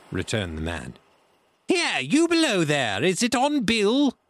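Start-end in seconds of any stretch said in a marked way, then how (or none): 1.7-4.2 high, loud pirate holler; cried question. A narrator's reading. Blind man to searcher below, high shout. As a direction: yell down to the men below, demanding. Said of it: "cried the blind man again".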